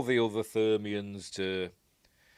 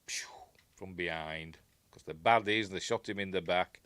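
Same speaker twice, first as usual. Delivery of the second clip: normal talking voice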